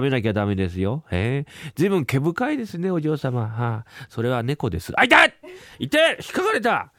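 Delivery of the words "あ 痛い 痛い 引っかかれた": high, loud cries of pain; pained yelp. Acted. Doctor scratched by the cat, high loud yelps. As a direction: yelp as the cat's claws catch you.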